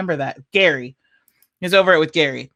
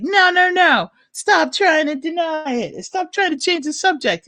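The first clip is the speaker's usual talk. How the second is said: high impatient voice; 10 semitones higher than usual. Retold or imitated. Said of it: "And he's like".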